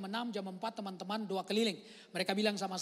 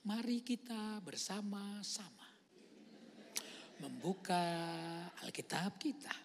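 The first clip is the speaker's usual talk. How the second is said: slow calm preaching; slower than usual. Retold or imitated. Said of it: "Dia itu khotbah itu biasa".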